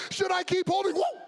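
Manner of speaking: high, loud mock-frightened call; panicked kid. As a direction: imitate a kid about to lose grip. Kid holding rope is dragged.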